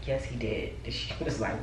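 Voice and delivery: usual speaking voice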